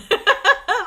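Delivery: a quick high laugh bursts out; bright burst of laughter. Real, not acted. Laughing at her own call to turn on. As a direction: laugh out loud, delighted.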